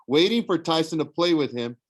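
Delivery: plain reading voice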